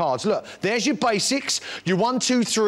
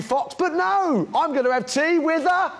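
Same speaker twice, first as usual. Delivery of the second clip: put-on excited child shout; 9 semitones higher, slower than usual. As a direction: shout it out like a thrilled toddler. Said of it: "And he goes".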